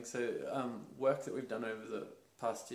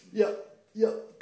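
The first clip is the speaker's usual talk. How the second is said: put-on high, loud eager child's yes; little boy agreeing to be a big boy; 9 semitones higher, 14 dB louder than usual. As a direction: squeak it out like a kid trying to be tough. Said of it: "little boy me going".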